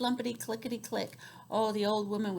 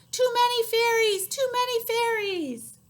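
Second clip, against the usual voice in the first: very high, loud, slow shout; desperate shout; 14 semitones higher, 9 dB louder, slower than usual. Shown as a demonstration. Old woman shouting at the crowd of fairies. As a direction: wail it out, overwhelmed. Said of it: "help the old lady shout".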